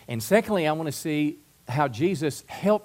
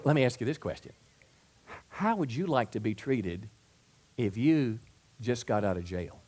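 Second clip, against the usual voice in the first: usual speaking voice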